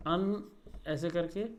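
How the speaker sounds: अन said very slowly as a nasal vowel; nasal vowel demo. Shown as a demonstration. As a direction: draw out अन nasally. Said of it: "इसमें". (अन को धीमे अनुनासिक स्वर में बोलें)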